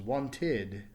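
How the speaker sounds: "wanted" slowly, with a clear extra "id" syllable at the end; teacher modelling "wanted"; clear teaching demo. Shown as a demonstration. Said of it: "Notice it is now two syllables".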